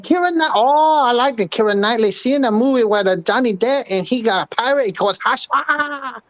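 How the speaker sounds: high mock Chinese accent; Mister Wang voice. [name] playing Mister Wang to the hosts, high mock Chinese accent. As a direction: raise the pitch into a broad Chinese accent. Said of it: "like Mister Wang".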